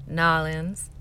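"Nolans" slurred into two syllables, NAW-linz; local Southern pronunciation. Shown as a demonstration. Vlogger offering the local version, "Nolans" slurred as NAW-linz. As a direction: run New Orleans together as "Nolans". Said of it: "I'll play it up".

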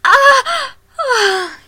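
very high, very loud cries of climax; cry of pleasure. Acted. A woman crying out at climax with her lover. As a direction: cry out sharply at the peak.

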